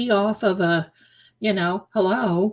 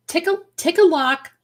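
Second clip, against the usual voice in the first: playful singsong; 9 semitones higher, 3 dB louder than usual. Real, not acted. Playful secret-keeping rhyme to chat.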